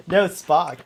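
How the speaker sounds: usual speaking voice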